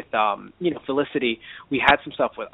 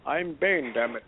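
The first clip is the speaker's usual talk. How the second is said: bad Bane impression; 2 semitones lower, 5 dB quieter, slower than usual. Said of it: "that's a really bad Bane impression because I have to put my hand over my mouth".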